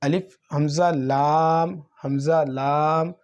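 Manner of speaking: الف حمزہ لام said slowly, حمزہ clearly stressed; slow spelling demo. Shown as a demonstration. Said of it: "تو ہم اسے اس طریقے سے پڑھتے ہیں".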